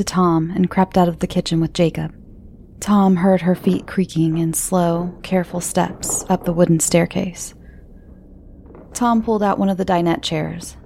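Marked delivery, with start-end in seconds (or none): none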